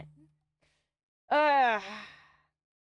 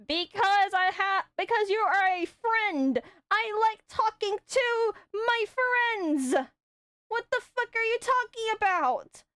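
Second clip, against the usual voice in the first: slow exasperated retort; 9 semitones higher, slower than usual. Retold or imitated. Retold reply to a guy friend.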